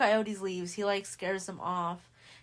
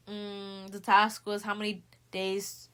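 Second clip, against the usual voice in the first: ordinary talk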